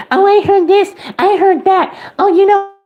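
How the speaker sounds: mock her high and snotty as she spreads rumors; mocking gossip voice. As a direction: sneer it like a petty gossip. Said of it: "lie and say".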